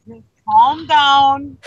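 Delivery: retold steadying command, drawn out; firm calming. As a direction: talk someone down, word by word. Retells soothing a panicking friend.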